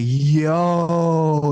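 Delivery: long, loud drawn-out yo; amazed reaction. Retold or imitated. Storyteller watching her kick the door, loud amazed drawl. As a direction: stretch it out in disbelief.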